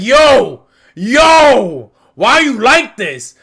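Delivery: shouted high and loud; mock yell. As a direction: yell at the screen in disbelief. Yelling at Frankie while watching.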